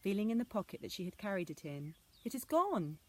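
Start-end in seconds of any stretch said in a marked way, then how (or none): 2.2-3.0 high, louder dismayed cry. Acted. Anna finding the letter gone, dismayed and louder. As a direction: wail it in dismay. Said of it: "she cried in dismay".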